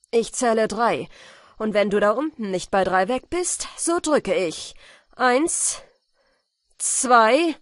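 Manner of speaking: loud furious countdown. A narrator's reading. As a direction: count it down in rage. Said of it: "knirschend vor Wut".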